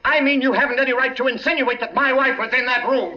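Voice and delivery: a rising, indignant outburst; angry denial. Acted. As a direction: hit back hard at the captain. Angrily denies wife's involvement.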